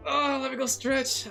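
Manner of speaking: normal talking voice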